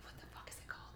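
fast, hushed whisper while searching memory; whispered to herself. Real, not acted. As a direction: mutter it under your breath, racing. Trying to recall a title.

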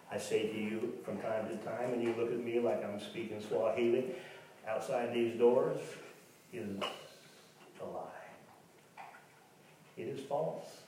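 ordinary talk, nothing marked

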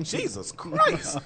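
drawn-out, high-pitched mock astonishment; retold shock. Man retelling his shock in a department store, mock astonished. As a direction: stretch it slowly, voice leaping up in imitated disbelief.

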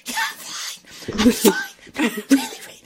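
hoarse croak. Retold or imitated. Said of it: "I have no vocal cords left".

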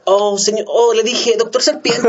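high, hammy Latin American dubbing voice; latin dub voice. Retold or imitated. Mocking Panchis with a high dubbed-cartoon voice. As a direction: overact like a bad cartoon dub. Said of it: "Y escuchas los doblajes".

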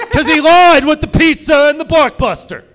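high, very loud accusation; indignant shout. Acted. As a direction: shout the betrayal out to the room. Punchline, betrayed kid shouting.

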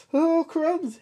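very high yelp; frightened snake. Acted. Snake sees monster.

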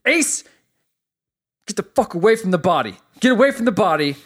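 retold furious yelling. Eyeball yelling at Ace to leave the body, frantic shout. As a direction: scream at him to back away from the body. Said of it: "Eyeball goes nuts".

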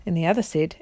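ordinary delivery

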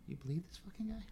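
whispered aside, high and hushed; a pope whispering in disbelief; incredulous whisper. Retold or imitated. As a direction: mutter it in disbelief to nobody.

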